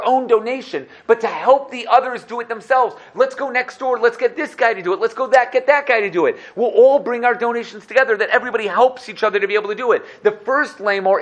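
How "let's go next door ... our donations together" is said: high, loud rallying call to give; rally a friend to donate now; much higher, louder enthusiastic push; eager urging. Acted. Voicing people urging each other.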